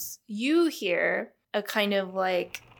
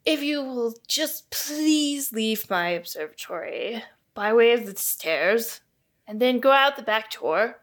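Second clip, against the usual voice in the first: put-on louder, deliberate invented accent; Caroline's put-on accent; 3 semitones higher, 5 dB louder than usual. Astronomer showing visitors out, in an emphatic put-on accent. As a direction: spell out the directions in a put-on accent. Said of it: "what this accent is I've decided on for Caroline".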